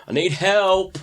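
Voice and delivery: high-pitched imitated cry for help; mock-frantic shout without full volume; retold shouting. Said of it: "a couple of minutes shouting".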